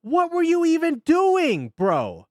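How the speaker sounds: shouting. Real, not acted. Reacting loudly to the race.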